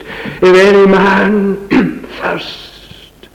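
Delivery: high, drawn-out call; proclaiming invitation. Acted. Preacher voices Jesus calling out at the great feast, proclaiming. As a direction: call it out slowly, holding the words high.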